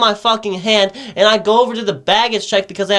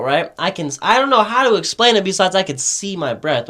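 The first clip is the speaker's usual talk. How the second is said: ordinary talk, nothing marked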